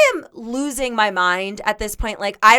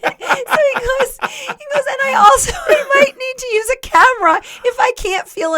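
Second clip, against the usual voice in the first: high, squeaky words through laughter; giggling retelling; laughing while quoting; 12 semitones higher than usual. Real, not acted. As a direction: struggle to get the quote out.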